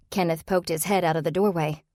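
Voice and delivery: plain reading voice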